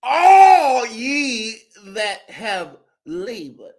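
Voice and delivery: mock pulpit cadence, stretched; preaching. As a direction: preach scripture to the flock. Mock sermon continues.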